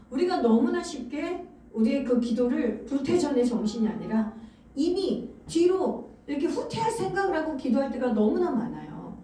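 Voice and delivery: normal talking voice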